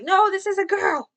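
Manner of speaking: put-on playfully insisting she's a girl; exasperated correction. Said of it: "and then I'm like".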